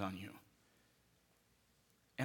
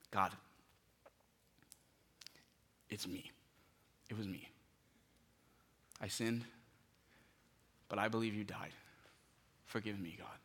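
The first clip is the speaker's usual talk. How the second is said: soft confession; 3 semitones lower, 6 dB quieter than usual. Shown as a demonstration. Preacher modelling a sinner's prayer, softly. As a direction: pray it hushed, like a confession.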